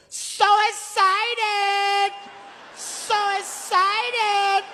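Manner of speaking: very high, long drawn-out squeal; mock excited cry. Japanese man locks eyes with worried rider, squealing excitedly. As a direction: stretch each word out high, imitating glee. Impression of fellow rider on coaster.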